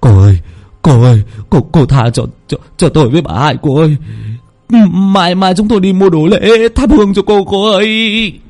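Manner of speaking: put-on urgent pleading young man; panicked begging. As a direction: promise offerings to appease her. Nam begs the ghost.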